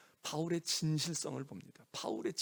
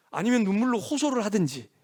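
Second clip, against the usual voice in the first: impassioned listing; 6 semitones higher, 11 dB louder than usual. Shown as a demonstration. Preacher acting out parents' desperate pleading.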